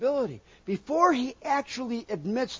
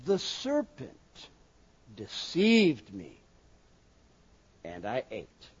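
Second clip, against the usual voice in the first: slow, sinking confession, dropping much lower and softer; Eve's excuse; 9 semitones lower, 4 dB quieter, slower than usual. Acted. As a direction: let it sink away, slowly. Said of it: "She says".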